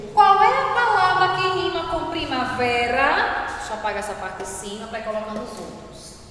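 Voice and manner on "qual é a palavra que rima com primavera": calling out to class. Real, not acted. Teacher calls the question to the class.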